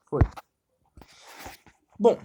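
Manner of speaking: normal talking voice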